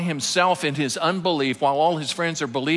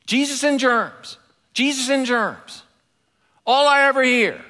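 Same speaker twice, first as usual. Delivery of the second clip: high, put-on voice of a cheeky six-year-old, last line quick; little boy's voice; 10 semitones higher, 4 dB louder than usual. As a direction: play the grumbling little boy, pitched high. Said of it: "he could be heard muttering under his breath".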